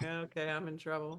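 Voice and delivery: low, soft aside in retelling; subdued apprehension. Retold or imitated. Woman recalling a pastor's hard call, subdued mock apprehension. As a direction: drop it down under your breath, mock-worried.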